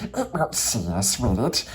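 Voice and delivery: sly, scheming creature murmur; Gollum whisper. Acted. Gollum plots against Bilbo, a sly creature whisper. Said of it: "he whispered to himself".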